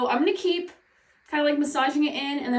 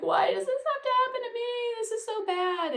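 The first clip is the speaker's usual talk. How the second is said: mock whining; 11 semitones higher than usual. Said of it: "I'm like".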